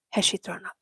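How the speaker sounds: brisk, businesslike question; all business, get right to it; serious vet. Retold or imitated. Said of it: "all business and very serious".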